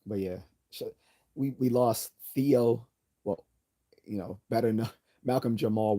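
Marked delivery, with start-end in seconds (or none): none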